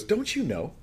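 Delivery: high-pitched, softly voiced disbelief; incredulous question. Preacher acts out Paul writing to people, incredulous. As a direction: ask it high, as if it were obvious.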